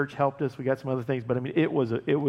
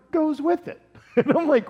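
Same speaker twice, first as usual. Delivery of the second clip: laughing; 13 semitones higher, 6 dB louder than usual. Real, not acted. Laughing at Aaron's choice.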